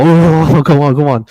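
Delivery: retold buck fever plea; excited pleading. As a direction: urge it on in hushed-turned-loud excitement. Buck fever, shaking in stand.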